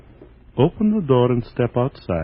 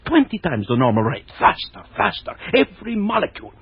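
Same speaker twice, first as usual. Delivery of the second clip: higher, fast frantic raving; manic raving; 5 semitones higher than usual. Acted. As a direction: rush the words like mad. Mad doctor raves again.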